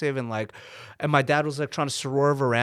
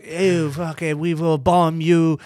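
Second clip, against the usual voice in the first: hammed-up Quebec French accent, louder, rolling the r's; mock Quebec accent; 3 semitones higher, 5 dB louder, slower than usual. Angry Quebec customer yelling at a brown family, mock Quebec accent. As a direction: spit it out like an angry Quebec man. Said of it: "That's the worst Quebec accent".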